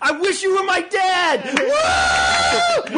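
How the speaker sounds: high-pitched mock teen squeal; squealing fangirl. One girl to the supposed dad, squealing excitedly. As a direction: shriek it adoringly, imitating a fan. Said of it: "then one girl goes".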